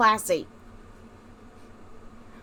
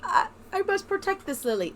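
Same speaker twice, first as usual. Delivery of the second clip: cooing; 10 semitones higher, 9 dB louder, much slower than usual. Real, not acted. Cooing over game character.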